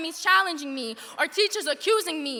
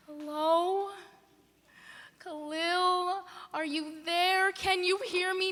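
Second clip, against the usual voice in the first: a soft falling Hello? with little hope left; hushed call; 2 semitones higher, 6 dB quieter, slower than usual. Acted. Calling her uncle again on a dead line, soft and fearful. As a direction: barely ask Hello? into the silence.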